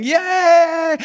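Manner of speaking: very high, loud squeal of joy; hammed-up child's cheer; cheering girl. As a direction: shriek it like a delighted little girl. Girl cheering in manure story.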